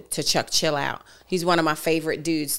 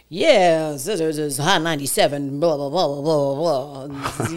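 1 mock DJ voice; 3 semitones lower than usual. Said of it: "I thought this was radio".

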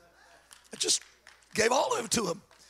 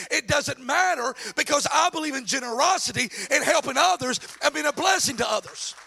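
full-force sermon shout; preaching; 7 semitones higher, 5 dB louder than usual. Acted. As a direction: proclaim it over the crowd.

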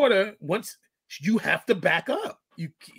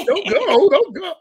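high, loud hammed-up plea; mock pleading kid; 10 semitones higher, 7 dB louder, slower than usual. Kids begging the parent not to back off, mock plea. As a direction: whine it like a clingy kid.

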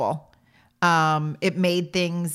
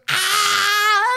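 put-on short loud high kid yell; mock scream straight at the baby; a boy's playful shriek; imitated kid scream; 12 semitones higher, 7 dB louder than usual. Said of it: "leans over towards her car seat and goes".

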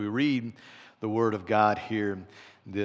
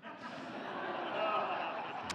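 laugh; 8 semitones higher, 9 dB quieter than usual. Real, not acted. Laughs at own joke.

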